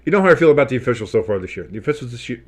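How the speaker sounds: rushed, over-caffeinated rant; talking too fast. Real, not acted. Fan griping to viewers about the officials, talking too fast. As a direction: race through it till the words trip. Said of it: "talking too fast. I had too much coffee".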